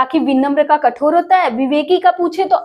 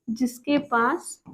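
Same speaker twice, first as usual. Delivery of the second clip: soft, very slow, word by word; slow dictation; 3 semitones lower, 9 dB quieter, much slower than usual. Real, not acted. Teacher writing out the phrase for students, slow soft dictation. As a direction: murmur it slowly as you write.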